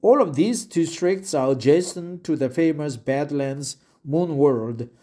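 usual speaking voice

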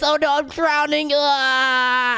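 put-on very high, shouting baby crying for help; high panicked baby voice. Stranded baby shouting to strangers for rescue, high panicked baby voice. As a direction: squeal for rescue like a tiny drowning baby.

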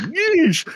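high-pitched squealed "yeesh"; squeamish yeesh. Retold or imitated. Everyone reacts to the recorded threat, high cringing yelp. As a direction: act out a cringe, voice shooting up.

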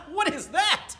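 high, loud taunting question; mocking older brother. Brother mocking Raymond's drawing, high loud taunt. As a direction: sneer at the drawing.

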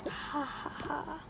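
slow, breathy scheming laugh; evil kid laugh. Acted. Kid gloating over the sleep potion, evil laugh. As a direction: cackle over the finished potion.

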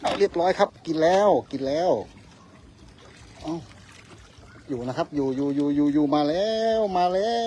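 real excitement, reeling it in; excited catch. Real, not acted. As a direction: angler on a sudden bite, excited. Fish bites, reeling in.

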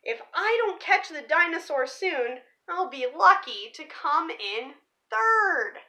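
ordinary delivery

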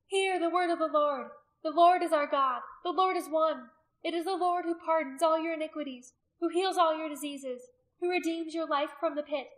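high, ringing scripture proclamation; loud proclamation. A narrator's reading. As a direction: preach it to a hostile crowd. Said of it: "loud enough for all to hear".

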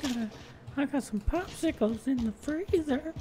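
high, silly put-on voice. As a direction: ham up a goofy character voice. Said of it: "don't ever try that voice again".

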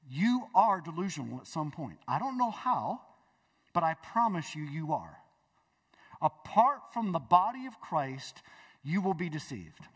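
normal talking voice

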